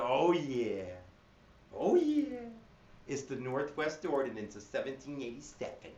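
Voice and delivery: silly mnemonic delivery; cray-cray voice. Shown as a demonstration. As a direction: ham it up so kids remember. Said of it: "I'm saying it like a cray-cray person".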